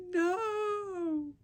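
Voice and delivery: high squealed refusal; sharp high no. Retold or imitated. To Rob pushing the baby's head, a high squealed no. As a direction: yelp no at him mid-push.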